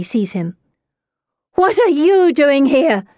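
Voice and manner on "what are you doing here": high, raised-voice demand; loud, high-pitched challenge; angry scream. A narrator's reading. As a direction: cry out in outrage at an intruder. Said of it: "she screams".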